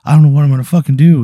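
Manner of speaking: fast, loud panic, hands behind his head; freaking out. Retold or imitated. Killer panicking to his friend over the body, fast loud panic. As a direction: blurt it out in a frantic rush. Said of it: "freaking out".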